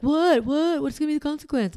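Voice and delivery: put-on a nervous child pressing for answers; anxious kid. Anxious child voiced by mom.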